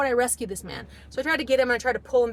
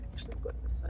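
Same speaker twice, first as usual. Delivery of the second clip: quiet aside; 11 dB quieter, much faster than usual. Real, not acted. Aside to someone while walking.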